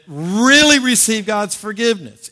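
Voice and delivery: "really" punched loud and high; stress on really. Shown as a demonstration. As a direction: hit "really" hard, then run on. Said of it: "I want to emphasize this word".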